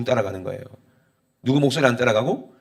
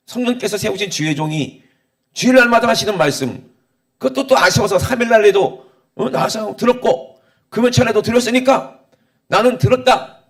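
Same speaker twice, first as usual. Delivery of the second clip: raised, emphatic listing of one's own credits; self-assured churchgoer; 7 semitones higher, 6 dB louder than usual. Acted. Churchgoer counting up every sermon attended, self-assured. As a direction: voice a satisfied churchgoer, higher and insistent. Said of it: "이렇게 생각하는 사람들이".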